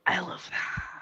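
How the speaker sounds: breathless whispered admiration; whispering. Real, not acted. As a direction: whisper it in awe. Admiring a friend's blanket.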